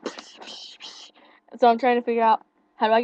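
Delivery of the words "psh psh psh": whispered psh beats; soft unvoiced mock cymbal hits; imitated drum sounds. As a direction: breathe out rock drum noises. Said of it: "He was going like".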